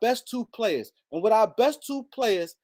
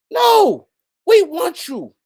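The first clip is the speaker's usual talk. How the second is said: loud outburst; 9 semitones higher, 9 dB louder, much slower than usual. Real, not acted. Rant flares up again.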